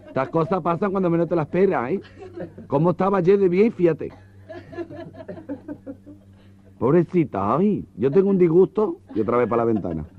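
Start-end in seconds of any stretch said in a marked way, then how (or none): 0.1-9.0 camp gossip. Acted.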